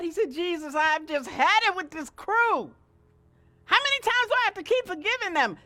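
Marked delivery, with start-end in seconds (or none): 0.3-2.7 louder, higher, exasperated plea; upset Peter. Retold or imitated. Peter complaining to Jesus, exasperated and loud. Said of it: "Peter got so upset". 3.7-5.6 loud, higher and fast complaint; fed up with forgiving; exasperated Peter. Retold or imitated. Peter asking Jesus, loud and exasperated. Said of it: "Peter got so upset".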